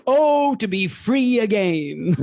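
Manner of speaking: high, theatrical sigh of relief; Frasier voice. Retold or imitated. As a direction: swoon it out like Frasier. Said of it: "Of course he says again".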